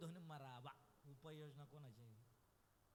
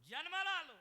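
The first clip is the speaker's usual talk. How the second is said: loud, high sermon cry; full-force preaching; 13 semitones higher, 17 dB louder than usual. Acted. Kirtankar telling the birth story, loud preaching. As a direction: preach it out to the back row.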